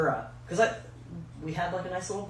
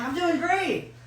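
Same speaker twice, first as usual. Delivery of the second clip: high-pitched mock squeal; match a friend's gushing, way up high; hammed-up delighted answer; excited reply; 13 semitones higher, slower than usual.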